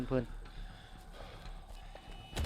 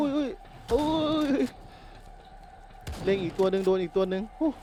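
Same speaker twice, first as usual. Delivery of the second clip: higher, excited gamer cries; excited whooping; 7 semitones higher than usual. Real, not acted. Gamer aims at one more and hits another, excited. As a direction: whoop as the shots land. Whooping while shooting in game.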